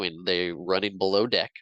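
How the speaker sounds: ordinary talk, nothing marked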